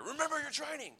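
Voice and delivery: high shouted drill order; mock army shout. Army leader shouting into a soldier's ear, high. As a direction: bark it into a recruit's ear. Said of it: "they'd shout it right in your ear".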